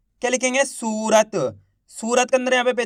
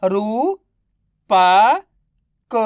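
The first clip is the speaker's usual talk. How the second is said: long pauses between रू, पा and क; syllable by syllable; 4 dB louder, much slower than usual. Shown as a demonstration. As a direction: sound out रू, पा, क separately, each drawn out. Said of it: "तो बताइए मिलके क्या बनेगा?". (रू, पा, क को अलग-अलग खींचकर)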